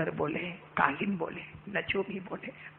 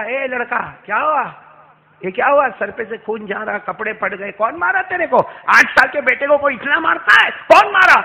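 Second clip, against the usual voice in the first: higher and louder with rising alarm; alarmed stranger; 5 semitones higher, 13 dB louder than usual. Acted. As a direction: demand who did it. Passer-by alarmed at bleeding boy.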